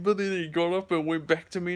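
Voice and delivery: usual speaking voice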